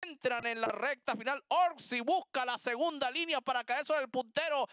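normal talking voice

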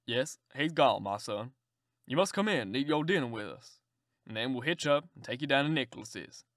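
put-on low, hearty old gentleman; a kindly old man ushering a guest in. Old gentleman inviting the stranger in, low and hearty.

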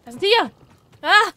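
loud, high cry at the tigers; excited shout. Real, not acted. Gamer charging the tigers, excited shout. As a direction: yell out as you charge the tigers.

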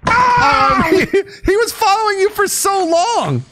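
high, laughing disbelief. Real, not acted. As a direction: squeal it through laughter. Laughing at friend's death.

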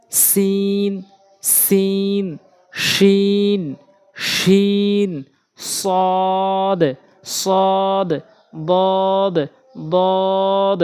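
"সিন", "শিন", "সদ", "বদ" each held long on a level pitch; model letter recitation. Shown as a demonstration. Teacher models letters for practice, long level "সিন" through "বদ". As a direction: chant each letter name slowly, "সিন" to "বদ", vowels stretched. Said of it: "এভাবে উচ্চারণ করবেন".

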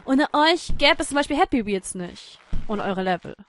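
usual speaking voice

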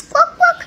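very high loud quacking; animal quack. Shown as a demonstration. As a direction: quack like a bird, squeaky. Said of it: "a chicken do like this".

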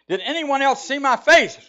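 high, loud screamed demand; robber's scream. A narrator's reading. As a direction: scream it at the frozen crowd. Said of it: "screams the robber".

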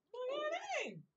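very high squeaky disbelief. Pretend. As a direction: squeak it out, incredulous.

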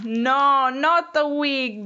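high, loud storybook "no"; exasperated refusal. Acted. Mouse rejecting the frog's wrong guess, exasperated. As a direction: push back firmly, drawing out the words slowly.

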